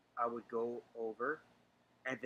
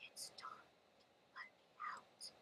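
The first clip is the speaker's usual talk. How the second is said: faint eerie whisper, much softer; 16 dB quieter than usual. Retold or imitated. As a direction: barely breathe the words out. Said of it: "a whisper going like".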